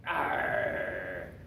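low, shouted pirate growl; pirate arr. Shown as a demonstration. Teacher prodding a shy men's class, shouted pirate growl. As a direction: roar a long, low "arr". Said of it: "what does a pirate sound like".

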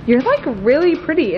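put-on quick valley-girl Regina George voice, "like" leaned on; Regina George accent. Reading her phone case aloud to her friend, light Regina George accent. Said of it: "I love how you changed the accent".

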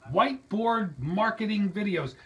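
ordinary talk

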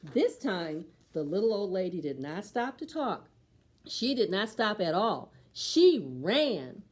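ordinary delivery, nothing marked

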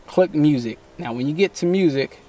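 ordinary talk, nothing marked

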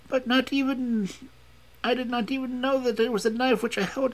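put-on very high, foreign-accented woman's voice; foreign woman confessing. A narrator's reading. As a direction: confess it all in a sustained high voice.

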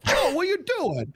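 high loud scolding mom voice; Italian mom. Retold or imitated. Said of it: "full Italian, smacks him".

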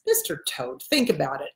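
low, soft coaxing scorpion; persuasive scorpion. Acted. Scorpion talking the toad into a ride, low soft persuasion.

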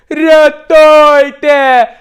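high, loud, long-drawn cry; cry for help. Retold or imitated. Capsized friend yells from the lake, loud cry. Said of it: "крик з озера".

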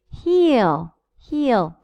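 Heel with a long ee. Shown as a demonstration. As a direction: hold the ee in Heel. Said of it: "You see the difference?".

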